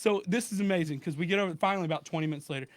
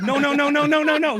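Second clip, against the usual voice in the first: rapid run of no's, much higher and louder; frantic no; 9 semitones higher, 9 dB louder than usual. Retold or imitated. Scammer stopping the victim from naming wrong cards, frantic and loud. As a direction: scammer cutting him off in alarm.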